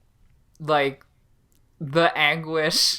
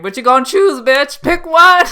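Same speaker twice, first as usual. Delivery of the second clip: high, loud taunting yell, climbing; re-enacted shout, rising to a peak; shouting at screen; 11 semitones higher, 12 dB louder than usual. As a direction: yell a challenge at the TV. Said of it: "just shouting at my screen".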